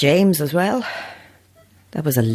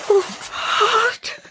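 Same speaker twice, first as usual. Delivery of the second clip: high, strangled gasp of a word; a cry of pure fright; terrified cry. Acted. A woman crying out in terror during the seance.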